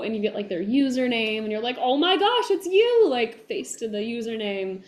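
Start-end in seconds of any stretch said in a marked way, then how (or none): 1.8-3.1 retold gasp of recognition, high and loud; delighted recognition. Actor re-enacting meeting a known username in person, delighted. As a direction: act thrilled to finally place someone.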